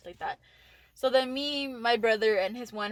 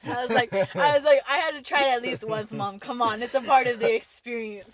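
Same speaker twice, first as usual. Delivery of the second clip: louder, fast, pleading excuse; rushed excuse; 6 dB louder than usual. Retold or imitated. Caught sneaking out, pleading with her mom, loud and rushed. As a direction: blurt it out, half laughing, caught red-handed.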